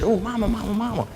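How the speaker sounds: put-on a child tugging at her mother; lightly voiced young daughter; little girl. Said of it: "and she said".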